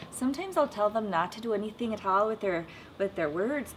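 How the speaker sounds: ordinary talk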